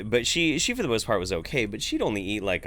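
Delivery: usual speaking voice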